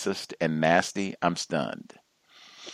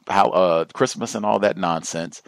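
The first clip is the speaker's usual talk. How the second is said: usual speaking voice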